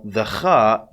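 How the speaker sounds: scraped kh in "kha"; scratchy kha. Shown as a demonstration. Teacher names it the scratchy one, scratchy kha. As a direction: say "kha" slowly with a scratchy throat sound. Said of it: "it's the scratchy one".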